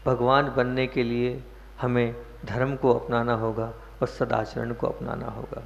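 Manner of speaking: normal talking voice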